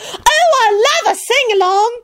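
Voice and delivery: high-pitched delighted squeal; giddy, high exclamation; gleeful scream. A narrator's reading. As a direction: squeal with glee. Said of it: "Marlena screamed gleefully".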